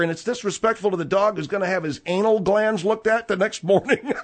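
laughing through the words; laughing mockery. Real, not acted. Laughs at absurd complaint.

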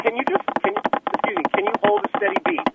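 ordinary talk, nothing marked

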